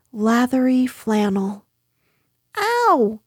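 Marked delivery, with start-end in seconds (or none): 2.6-3.2 high yelp at normal volume; Piglet crying out. A narrator's reading. Piglet being scrubbed by Kanga, yelping. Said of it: "cried Piglet".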